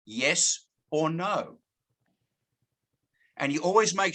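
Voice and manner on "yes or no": Yes or no? drawn out slowly, each word given time; slow it right down and let them choose; slow alternative close. Shown as a demonstration. Salesperson offering a prospect the choice, slow and deliberate. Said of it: "You say".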